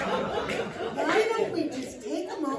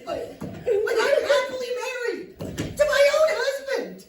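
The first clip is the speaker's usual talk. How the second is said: high, loud, stammering insistence; stammer, then blurt it out high and loud; frantic protest; 8 semitones higher, 6 dB louder than usual. Acted. Psychologist telling lovestruck client of own marriage, frantic protest.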